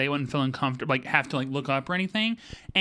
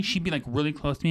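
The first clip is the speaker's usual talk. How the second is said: normal talking voice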